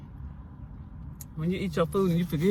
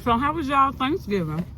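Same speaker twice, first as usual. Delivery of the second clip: talking with mouth full; words round a mouthful of food; cheeks stuffed full; 7 semitones higher than usual. Real, not acted. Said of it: "talking with my mouth full".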